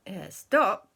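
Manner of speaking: ordinary delivery, nothing marked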